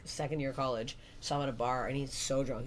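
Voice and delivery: normal talking voice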